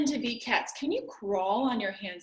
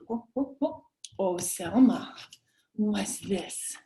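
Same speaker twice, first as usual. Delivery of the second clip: ordinary talk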